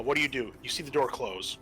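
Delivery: ordinary talk, nothing marked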